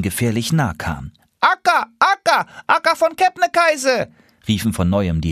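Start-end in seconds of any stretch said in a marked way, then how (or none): 1.4-4.1 high, urgent goose calling. Acted. Rear geese calling the lead goose again, high call.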